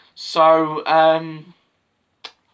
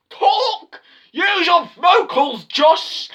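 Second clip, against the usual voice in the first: much higher, louder squeaky silly voice; mock pep talk; 14 semitones higher, 5 dB louder, much slower than usual. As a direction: yell at yourself in a cartoon voice to talk louder. Cheering himself on, mock coach.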